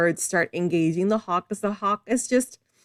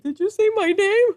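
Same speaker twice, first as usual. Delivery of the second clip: much higher, perky pretend dog; 14 semitones higher, 4 dB louder than usual. Voicing her dog reacting to its name, high dog voice. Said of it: "she's looking at me like".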